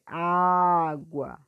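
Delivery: "água" with a long, loud Á; teacher drawing out Á in "água"; stretched stressed syllable. Shown as a demonstration. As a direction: hold the first "á" in "água". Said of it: "puxando mais a sílaba forte".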